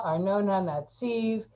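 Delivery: ordinary talk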